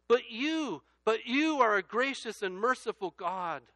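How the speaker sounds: loud, high, slowed refrain hitting each you; impassioned refrain. Acted. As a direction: hammer the refrain home. Refrain building, preached loud.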